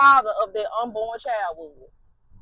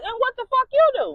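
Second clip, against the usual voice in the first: higher and louder, outraged disbelief; shocked friend; 12 semitones higher, 5 dB louder than usual. Retold or imitated. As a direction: friend can't believe it, voice jumps up.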